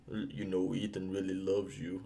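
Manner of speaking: low, soft, mock-depressed delivery; Luther. Mocking Luther's sad line to Ethan's love, low and subdued. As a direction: imitate a glum line reading, hushed and downcast. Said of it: "all sad and depressing".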